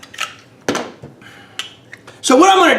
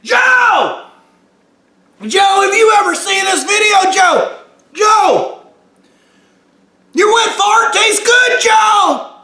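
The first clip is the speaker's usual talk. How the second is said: calling out; 7 semitones higher than usual. Real, not acted. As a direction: yell across to him. Calling out to Joe.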